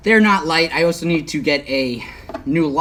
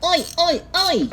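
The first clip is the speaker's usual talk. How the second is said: high-pitched Australian "Oi, oi, oi!" at normal volume; Aussie "oi" call; 13 semitones higher than usual. Pretend. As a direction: sing it out like an Aussie mate. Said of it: "Australians would know what that means".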